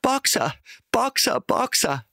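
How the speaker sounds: very high, calling out; desperate cry. A narrator's reading. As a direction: call him again and again. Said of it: "rief sie". (sehr hoher, verzweifelter Ruf)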